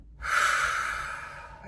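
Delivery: long audible breath out; slow relaxing sigh of released breath; deep exhale. Shown as a demonstration. Guide showing listeners how to breathe deeply, long exhale. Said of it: "Take a deep breath in".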